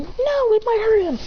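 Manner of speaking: high-pitched panicky warning; acted alarm, pitch way up; alarmed protest. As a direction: cry out to stop them. Alarmed as weapon is thrown.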